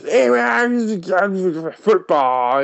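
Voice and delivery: high, slow put-on accent; K's accent. Imitating coworker K talking football, slow high accent. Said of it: "And the word football is".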